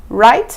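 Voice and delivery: "right" rising as a question; questioning tag. Shown as a demonstration. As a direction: lift "right" at the end to check. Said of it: "Sempre termina com interrogação".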